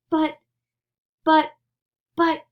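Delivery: high, loud stammering panic; alarmed shout. Acted. Grandpa Joe alarmed about the glass elevator, high stammering shout. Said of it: "shouted Grandpa Joe".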